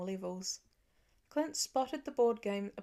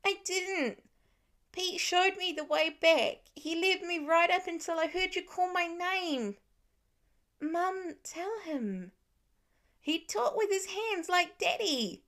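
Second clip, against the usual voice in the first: put-on higher, louder child voice, worked up; upset insistent little boy; 9 semitones higher, 4 dB louder than usual. A narrator's reading. Nate protesting to his family, higher louder upset child. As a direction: push back hard like a frustrated five-year-old.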